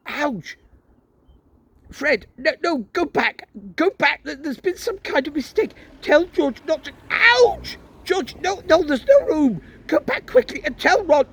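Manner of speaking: put-on stuck and bossing the boys back; flustered father. Father trapped in blocked fireplace.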